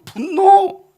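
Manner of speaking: high, loud burst on the word; acted anger. Preacher acting out the general's rising rage, loud outburst. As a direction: explode at the top of the list. List of feelings builds to anger.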